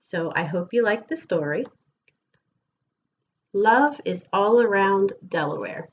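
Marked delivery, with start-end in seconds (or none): 3.5-5.9 lilting, sing-song picture book reading; gentle storytime voice with bouncing rhythm; reading to children. Acted. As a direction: read the rhymes aloud to a class of kids. Reads picture book to class.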